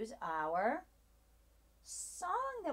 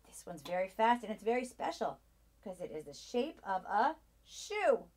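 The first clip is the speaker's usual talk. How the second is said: ordinary delivery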